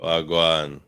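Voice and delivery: host echoing the Jamaican wagwan; a lighter Jamaican wagwan back; Jamaican accent. Retold or imitated. Host answering the greeting in a Jamaican accent. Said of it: "they crack on my Jamaican accent".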